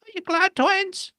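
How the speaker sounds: high, bright Wendy question; voicing Wendy. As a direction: ask the boys sweetly. Wendy asks the twins.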